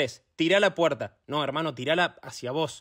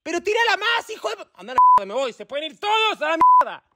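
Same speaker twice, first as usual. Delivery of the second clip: high, loud ranting; furious outburst; 11 semitones higher, 4 dB louder, faster than usual. Acted. Instructor quitting on his hopeless pupil, furious. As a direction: blow up and storm off cursing.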